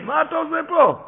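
very high, incredulous question; puzzled uncle, voice pitched right up; surprised questioning. Acted. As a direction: act out an astonished question. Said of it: "שאל אותו".

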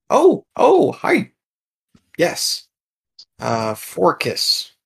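high startled greeting, settling into a hesitant introduction; a flustered little creature introducing itself. Acted. Creature in the dark greets the approaching adventurer, startled. GM voices the creature found murmuring.

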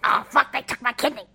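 lightly voiced cartoon character in alarm; Charlie the Unicorn. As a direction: do a gruff unicorn's outburst. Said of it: "trying to do Charlie the Unicorn".

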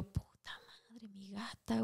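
breathless scared whisper, slowed. Retold or imitated. Waking in the dark, frightened for her cat, whispered. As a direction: hush it, frightened, half asleep.

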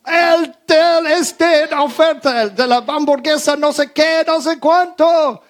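high, loud shouting over a barrier, slowing at the end; shouting to be heard. Retold or imitated. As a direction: strain to be heard through the glass. Shouting through mask and screen. (gritando alto para que le oigan)

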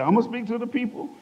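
you can't believe what you're hearing; a shy man stunned by a stranger's prophecy; incredulous question. Retold or imitated. Repeats in disbelief.

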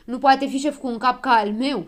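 snapped back. A narrator's reading. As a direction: throw the insult back indignantly. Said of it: "se răsti Habar N-am".

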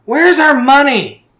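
loud, high demanding shout; angry demand. Acted. Mother Donna demands money from her kids, shouting. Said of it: "Donna walks in and goes".

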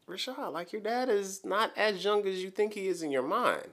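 retold reasoning with himself in a high voice; self-reality check. Man telling himself his dad is aging, high-pitched reality check.

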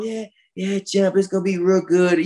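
put-on light Mexican accent, mild and soft-spoken. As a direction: imitate the champ's gentle Mexican accent, lightly. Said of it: "just real soft-spoken and don't talk a lot of shit".

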